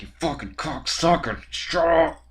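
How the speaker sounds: furious shout at the neighbors; angry yell. Retold or imitated. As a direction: bellow an insult down through the floor. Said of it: "It's like".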